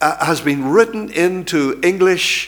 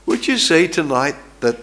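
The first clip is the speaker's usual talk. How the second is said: ordinary talk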